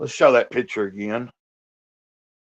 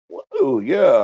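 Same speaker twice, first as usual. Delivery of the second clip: excited whoa; 3 semitones higher than usual. Retold or imitated. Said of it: "I'm like".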